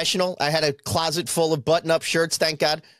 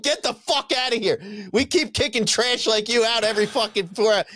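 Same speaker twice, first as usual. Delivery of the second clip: higher-pitched mock outrage, not shouted; retold angry ejection; 7 semitones higher than usual. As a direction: imitate staff indignantly throwing someone out. Retold store staff throwing him out.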